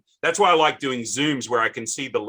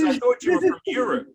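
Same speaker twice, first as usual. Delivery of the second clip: much higher mimicking voice; surprised caller; 11 semitones higher than usual. Host imitating the caller who mistook his origin, surprised.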